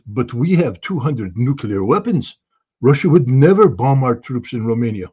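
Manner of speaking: hammed-up French general's accent; bad French accent. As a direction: put on a clumsy mock-French accent. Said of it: "that's my worst French accent in the world".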